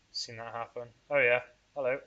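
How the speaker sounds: normal talking voice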